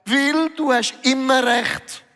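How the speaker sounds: lightly voiced Jesus, gently teasing; a hint of Jesus answering him; voicing Jesus. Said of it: "Und Jesus sagt".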